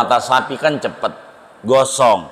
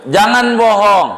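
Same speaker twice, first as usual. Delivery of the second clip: stern warning; 8 semitones higher, 4 dB louder than usual. Real, not acted. Preacher's loud warning.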